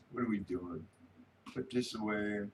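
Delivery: ordinary delivery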